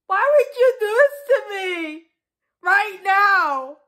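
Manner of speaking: tearful melodramatic pleading; tragic heroine sorrow. Acted. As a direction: sob it out like a soap heroine. Melodramatic breakup, crying.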